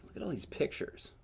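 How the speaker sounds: usual speaking voice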